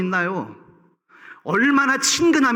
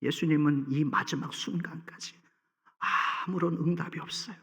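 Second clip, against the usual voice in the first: hushed whisper, much softer; 5 semitones lower, 10 dB quieter than usual. Real, not acted. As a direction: drop to a near whisper for weight. Preacher drops to whisper. (속삭이듯 낮게)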